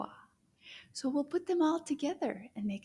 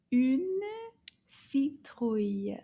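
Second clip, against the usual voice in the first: Une citrouille drawn out slowly, une stressed; slow model repeat; slower than usual. Shown as a demonstration. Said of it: "Can you say".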